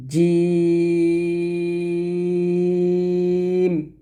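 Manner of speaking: জিম held very long and slow; drawn-out jim. Shown as a demonstration. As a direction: stretch জিম out at length. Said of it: "এরকমভাবে".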